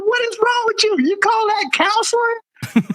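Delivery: high-pitched incredulous scolding; indignant disbelief. Retold or imitated. Sergeant retells confronting an airman over bad counseling, indignant disbelief. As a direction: demand an answer, voice shooting way up.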